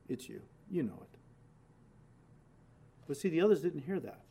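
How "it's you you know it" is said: much softer, barely above a whisper; quiet private answer. Acted. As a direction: say it low so only Judas hears. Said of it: "because Judas was whispering in the Lord's ear".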